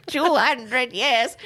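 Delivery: high, very slow mock lament; ancient old man. Podcaster echoing the co-host's joke, hammed-up ancient-man lament. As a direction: draw it out as if centuries old.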